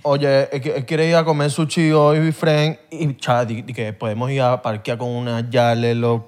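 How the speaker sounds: put-on lightly done Panamanian accent and slang; a buddy talking Panamanian slang, dragging out the di que; Panamanian accent imitation. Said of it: "me gustaba imitarlo con mis panas". (imitación ligera del acento panameño entre amigos)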